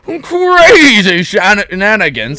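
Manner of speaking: high, loud, slow pompous voice; pompous principal. Acted. Principal greets the boy in his pompous voice. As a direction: purr like a self-satisfied old gent. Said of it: "This voice requires this arm position".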